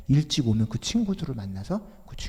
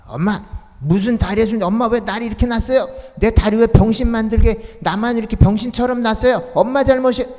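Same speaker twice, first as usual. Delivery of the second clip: much higher, much louder furious shout; crippled boy screaming at his mother; angry outburst; 10 semitones higher, 10 dB louder than usual. Retold or imitated. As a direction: yell at mother in blame. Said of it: "막 화를 내고 밥상 확 뒤집어 엎고".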